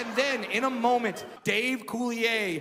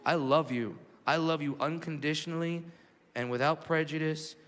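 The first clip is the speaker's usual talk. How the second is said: tender awe; 7 semitones lower than usual. Retold or imitated. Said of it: "staring at him going".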